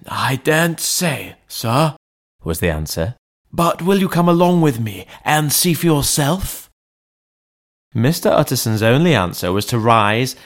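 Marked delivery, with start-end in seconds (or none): 0.1-6.7 higher, worried servant voice; a frightened old butler; anxious butler. A narrator's reading. Butler Poole in story.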